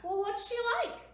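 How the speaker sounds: high, loud questioning voice; asking. Acted.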